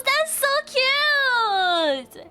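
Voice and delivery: high, slow drawn-out gush; gushing squeal. Real, not acted. Streamer gushing to chat about nails. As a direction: stretch it out, melting over the cuteness.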